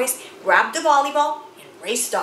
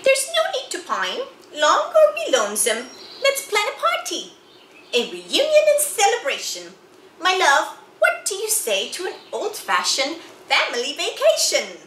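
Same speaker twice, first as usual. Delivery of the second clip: higher, bright, coaxing wife; cheerful wife voice; 6 semitones higher than usual. Acted. Wife proposes a family vacation to cheer Moose up, higher eager voice. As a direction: pitch the idea to lift his spirits, higher.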